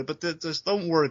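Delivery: ordinary talk, nothing marked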